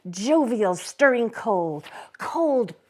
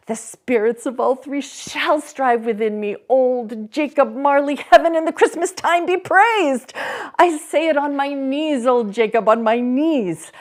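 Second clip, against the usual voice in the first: loud, high fervent praise; ecstatic Scrooge; 7 semitones higher, 7 dB louder than usual. Acted. Scrooge on his knees thanking Marley, ecstatic. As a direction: praise heaven with joyful fervour.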